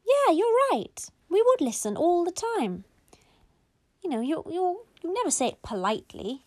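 loud, high big-sister voice. A narrator's reading. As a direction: chime in high and emphatic, like a child. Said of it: "And then the big sister".

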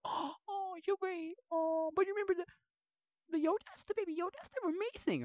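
imitating adoring fans; gasping mock adoration. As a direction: gasp then gush like a smitten fan.

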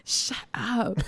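voiceless, amused shut-up; whispered shush. Real, not acted. Storyteller to teasing co-host, playful whisper. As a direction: whisper it back at the teaser. Teller answers co-host's teasing.

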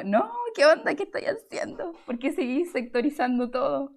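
mock outraged singer protesting gendered notes; incredulous protest. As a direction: squeak out the outrage at being boxed in. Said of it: "y es como".

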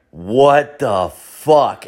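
very slow, drawn-out shock; imitated stunned disbelief. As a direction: act out a bystander stunned word by word. Stunned counter guy staring, mouth open.

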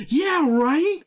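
high-pitched, excited self-imitation; really into it. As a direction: jump in with exaggerated, squealed agreement. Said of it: "I would get really into it".

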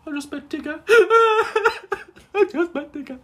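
very high squeaky voice, breaking up; laughing squeal. Acted. Fan after the character walks off, squealing and laughing. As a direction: squeal it through helpless laughter.

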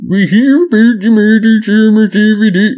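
high, loud mock-Swedish babble; Swedish Chef. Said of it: "that's just full on Swedish Chef".

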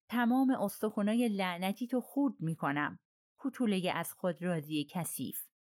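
ordinary delivery

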